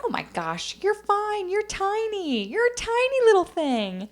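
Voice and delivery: high-pitched, cooing imitation of a gushing stranger; gushing reassurance. Stranger tells a customer she's tiny, gushing in a high voice. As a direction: leap up high and gush.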